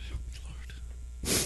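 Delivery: retold breathless prayer; barely voiced, hushed plea; whispered plea. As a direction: whisper a desperate appeal. Tearful woman's plea, retold.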